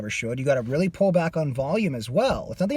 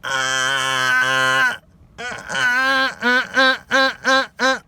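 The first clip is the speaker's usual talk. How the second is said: short grunted ahs rising higher; grunted compression ahs; 9 semitones higher, much slower than usual. Shown as a demonstration. Coach demos grunting ahs with compression. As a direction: chop each ah with a glottal grunt. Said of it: "glottal compression like you would with a grunt".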